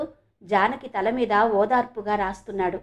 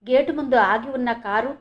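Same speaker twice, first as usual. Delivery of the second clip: usual speaking voice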